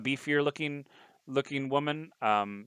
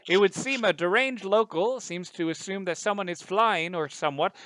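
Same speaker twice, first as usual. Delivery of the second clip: reporter voice; 8 semitones higher, 4 dB louder than usual. Acted. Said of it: "he's like".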